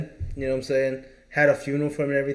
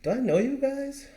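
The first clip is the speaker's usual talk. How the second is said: confused wife; 11 semitones higher, 3 dB quieter than usual. Retold or imitated. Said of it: "and she goes".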